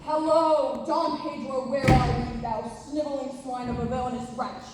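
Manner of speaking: loud theatrical villain bellow. Acted. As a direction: ham it up as a stage villain. Jo playing villain Don Pedro.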